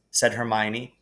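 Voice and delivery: usual speaking voice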